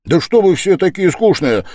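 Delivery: high, loud outburst; abrupt high-pitched shout; sudden exclamation. A narrator's reading. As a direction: cry out in exasperation at the company. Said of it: "вскрикнул он вдруг совсем неожиданно".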